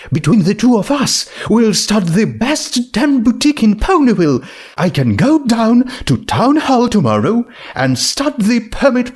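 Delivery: high, excited business plans; rising with enthusiasm, very high; Rarity voice. Acted. Rarity eagerly planning the boutique, high and bright.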